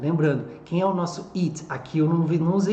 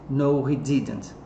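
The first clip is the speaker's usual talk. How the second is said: ordinary talk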